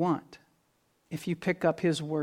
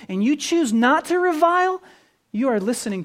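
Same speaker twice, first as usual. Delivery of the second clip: higher, louder, driving sermon voice; fervent pulpit preaching; preaching at full force; 7 semitones higher, 5 dB louder than usual. Acted. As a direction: build it up like a pulpit climax. Pastor preaches on obeying Jesus.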